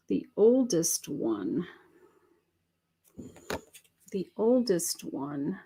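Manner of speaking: murmured repeat, searching for meaning; quiet musing. Shown as a demonstration. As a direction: mull the phrase over quietly. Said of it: "I gotta get clarity on that one".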